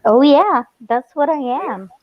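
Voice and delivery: high, excited agreement. Real, not acted. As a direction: play it up, proud. Playful boast.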